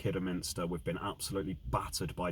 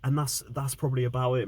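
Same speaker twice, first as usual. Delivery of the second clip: heated, raised fan rant; angry rant; 4 semitones higher than usual. Real, not acted. Fan ranting about team's loss.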